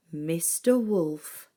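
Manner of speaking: plain reading voice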